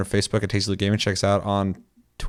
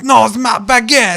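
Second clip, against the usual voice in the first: slow, loud, high mock French refusal; cling to it in a hammed-up French accent; 13 semitones higher, 9 dB louder than usual. Ubisoft's boss refusing Vivendi, slow loud mock French accent. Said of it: "With my perfect accent".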